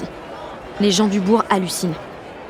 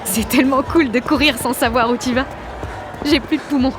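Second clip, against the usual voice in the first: elated, higher-pitched panting speech; out of breath; 4 semitones higher than usual. Acted. Jeanne runs through town with Luigi, breathless glee. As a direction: gasp it happily after a run.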